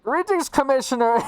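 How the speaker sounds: high, loud theatrical villain greeting; Skeletor voice. Retold or imitated. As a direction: ham up the cartoon villain hello. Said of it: "he's like".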